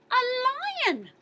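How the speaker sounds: ordinary delivery, nothing marked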